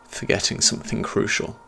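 plain reading voice

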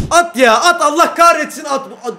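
loud desperate urging; frantic yell. Real, not acted. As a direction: scream at the player to shoot. Urging shot in anger.

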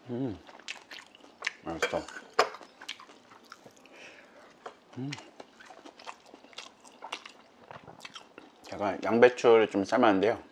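talking between bites; chewing. Real, not acted. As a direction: mouth full of food. Seen 1: Eel bite at -2; chews throughout, adds rice bite at 3.6.

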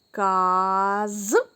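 Каз-ка split into two slow syllables. Shown as a demonstration. Teacher reads the word to children, syllable by syllable. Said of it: "Трошки складніші слова".

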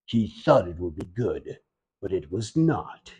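low, soft grim narrator aside; mock narrator voice. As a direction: intone it like an ominous documentary. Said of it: "Narrator's voice".

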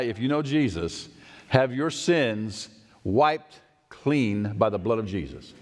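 ordinary talk

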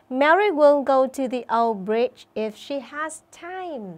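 slow, clear model sentence for pupils; model reading. Shown as a demonstration. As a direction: read it slowly so the class can copy.

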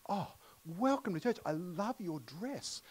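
a lightly voiced, delighted welcomer at the door; high, beaming imitation of a young woman's greeting; gushing welcome. Pastor voices Georgia welcoming people.

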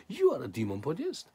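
acting out an outraged accuser; retold accusation. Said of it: "these people say to Jesus".

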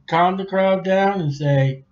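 ordinary talk, nothing marked

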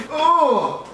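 high-pitched "ugh" that drops off; high-pitched groan. Real, not acted. Vlogger reacting to getting coffee too, high groan. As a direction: groan high above normal speech.

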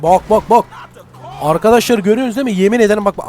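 normal talking voice

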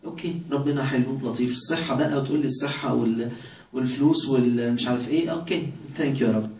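ordinary delivery

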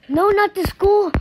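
high, loud protest; alarmed cry. Real, not acted. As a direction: yell in excited dismay. Alarmed at shooter's next target.

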